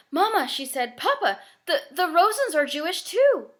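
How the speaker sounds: put-on a child struck by a frightening thought; girl alarmed about her friends, high and urgent; sudden alarm.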